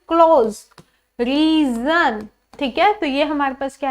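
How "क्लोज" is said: क्लोज said louder with a buzzing z at the end; z sound in close. Shown as a demonstration. Teacher to students, stressing the z in close. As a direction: land on the z of क्लोज. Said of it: "तो मैं क्या बोल रही हूँ".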